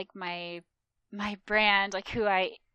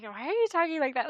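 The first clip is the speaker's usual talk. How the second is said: puzzled question; 7 semitones higher, 5 dB louder, faster than usual. Retold or imitated.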